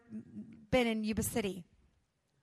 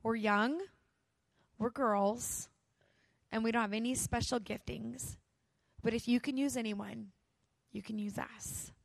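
simple prayer; 6 dB quieter than usual. Retold or imitated. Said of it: "saying this simple prayer".

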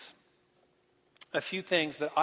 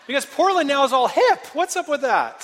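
much higher and louder, half-joking rant; mock indignant outburst; 10 semitones higher, 10 dB louder than usual. Real, not acted. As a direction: blow up in playful outrage at Portland. Mock outrage at hip Portland.

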